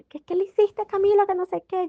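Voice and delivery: put-on high, panicked questioning of a child; a mother demanding answers in a fright; worried mom confronting the kids, high-pitched and stressed. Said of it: "estresada, preocupada".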